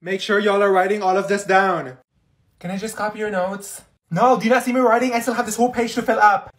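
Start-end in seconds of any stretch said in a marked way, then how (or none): 0.0-2.0 strict teacher. Acted. Teacher ordering class. 4.1-6.5 loud, high irritated snap; busy student snapping at classmate. Acted.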